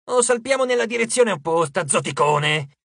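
high start, dropping on the insult; impatient retort ending in a jibe; exasperated outburst. A narrator's reading. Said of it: "sbottò Hedge".

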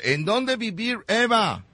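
sing-song pretend English question; mock English. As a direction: ask it like broken English. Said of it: "Otra vez".

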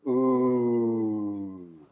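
long sustained ooh sound; drawn-out ooh. Shown as a demonstration. Teacher leads class in a drawn-out ooh. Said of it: "everybody go, ooh".

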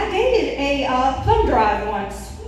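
usual speaking voice

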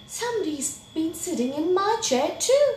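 high, quick, rising dismay; shocked Mommy Bear. A narrator's reading. Mommy Bear seeing her chair was used, high shock. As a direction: pitch it up and rush it.

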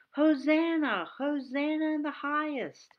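high, loud cry of Hosanna in the highest; shouting praise. Retold or imitated. Children seeing Jesus coming, high loud praise shout. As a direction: cheer like kids seeing Jesus arrive. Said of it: "they had to shout".

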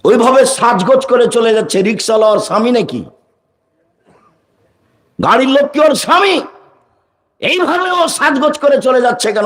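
high-pitched, scandalized rhetorical questions; indignant preaching. Acted. (ক্ষুব্ধ ওয়াজের চড়া সুর)

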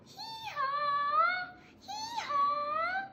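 high braying hee-haw; braying donkey. Acted. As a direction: bray like a donkey. Child reading donkey sound.

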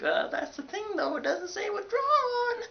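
very high, drawn-out mock whine; whiny protest. Student objecting to the registrar's office, mock whine. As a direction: plead in an exaggerated high whine. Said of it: "And I was like".

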